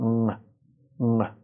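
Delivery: ام with a short neutral vowel; ام as "uhm", unstressed; schwa-m onset. Shown as a demonstration. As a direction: say ام with a lazy uh before m.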